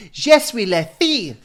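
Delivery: put-on high, loud little-girl voice; child in French advert. Host retelling Muzzy advert, loud high girl voice. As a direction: pipe it like a child in a language video. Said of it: "two kids on the advert that went".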